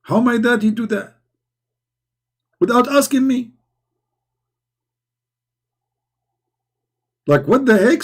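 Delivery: high-pitched, aggrieved complaint; mock upset. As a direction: play up being really upset. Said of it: "I'm really upset".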